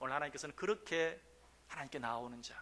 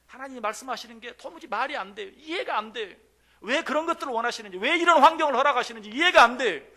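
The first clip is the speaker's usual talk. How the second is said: loud, high exasperated plea; impassioned preaching; 8 semitones higher, 13 dB louder than usual. Acted. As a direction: preach the confusion with full force. Preaching at full force.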